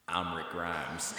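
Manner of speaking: slow country American drawl; country American accent. Retold or imitated. Fake Brit showing off for locals, country American accent. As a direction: put on a thick country American accent, slowly. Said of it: "like, country, this most country American".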